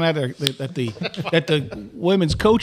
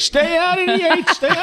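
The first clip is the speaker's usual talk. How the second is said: high-pitched imitation of a yelling coach; mock coach's yell; 12 semitones higher than usual. Coach shouting to players at first scrimmage, mock yell. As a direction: call it out high, like across a field. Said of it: "Johnny's yelling".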